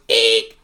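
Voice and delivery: loud comic eek; short, sharp squeal of fright; frightened shriek. Acted. Donald seeing the bearskin rug, sudden loud shriek.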